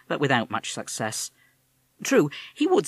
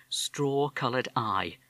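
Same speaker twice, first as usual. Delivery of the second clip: usual speaking voice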